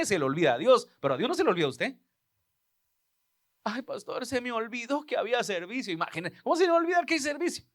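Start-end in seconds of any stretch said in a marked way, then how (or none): 3.6-5.9 higher, softer whiny churchgoer excuse; mock excuse voice. Pastor mocking a member who forgot service, whiny and higher. 6.4-7.6 much higher and fast disbelief; incredulous outburst. Real, not acted. Pastor scoffing at a forgotten service, high and fast.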